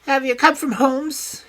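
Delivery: high, loud, sharp query; a suspicious man pouncing on a name; high alert villain voice; high tense question. A narrator's reading. Smith suddenly alert.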